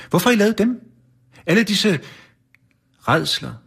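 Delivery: high, strained rising protest; a horrified Santa's voice climbing; upset Santa. A narrator's reading. Santa confronts the elves in a high, strained voice. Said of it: "skulle han til at sige".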